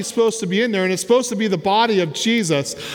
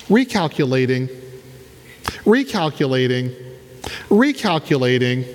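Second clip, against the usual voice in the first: GPS voice; 7 semitones lower than usual. Retold or imitated. GPS voice imitated.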